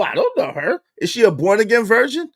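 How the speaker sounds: ordinary talk, nothing marked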